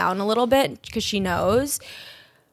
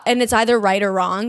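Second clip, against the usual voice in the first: ordinary delivery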